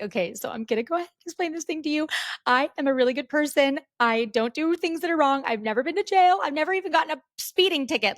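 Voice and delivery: high, fast, frantic pleading to the nurse; panicked pleading. Retold or imitated. Retelling pleading to the nurse, high and frantic. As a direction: beg like your whole life is on the line.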